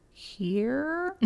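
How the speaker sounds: HERE drawn out, rising high; puzzled answer. Retold or imitated. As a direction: stretch HERE upward like a question.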